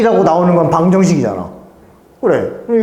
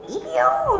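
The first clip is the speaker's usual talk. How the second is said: very high, softer mimicked class chorus; kids in unison; 5 dB quieter, much slower than usual. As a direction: recall the children's squealed answer, softly. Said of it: "이러면서". (아이들 합창을 작고 아주 높게 흉내)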